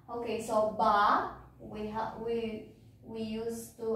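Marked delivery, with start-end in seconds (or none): none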